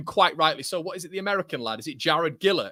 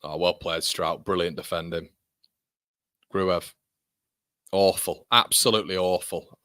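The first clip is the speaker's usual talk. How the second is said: usual speaking voice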